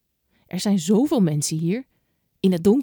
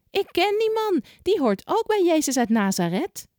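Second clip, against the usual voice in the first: put-on a much higher girl's call; second girl; 10 semitones higher than usual. A narrator's reading. Second girl calling out to the crowd, much higher. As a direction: catch everyone's attention as the girl. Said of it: "zegt een ander meisje".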